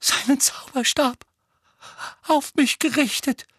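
put-on high-pitched, halting boy's voice; mumbling boy. A narrator's reading. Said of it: "murmelte Dudley".

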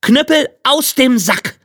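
high, ringing command; calling out. A narrator's reading. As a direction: call out the magic order to the cudgel. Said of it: "rief er". (hoch ausgerufener Befehl)

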